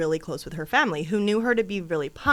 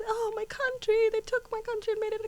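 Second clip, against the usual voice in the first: much higher, exasperated abuela voice; grandma impression. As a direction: mimic a grandma lamenting her country. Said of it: "she literally is like".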